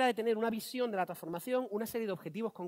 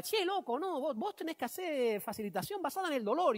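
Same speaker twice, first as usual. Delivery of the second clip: higher, lilting Argentine imitation; imitated Argentine accent; 7 semitones higher than usual. Colleague pitching pain-based facilitation to the speaker, imitated Argentine accent. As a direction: put on a lively Argentine voice. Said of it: "es argentino".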